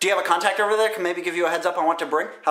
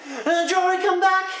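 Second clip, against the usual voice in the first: much higher, whiny call-back; mock pleading call; 12 semitones higher than usual. Vlogger calling Jory back, high mock plea. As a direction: plead like a clingy friend.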